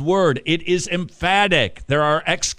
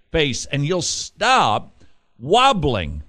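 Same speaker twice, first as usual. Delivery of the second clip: ordinary delivery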